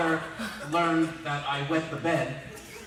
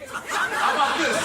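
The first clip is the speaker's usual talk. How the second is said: mocking father; 13 semitones higher, 8 dB louder, slower than usual. Father shows the April page.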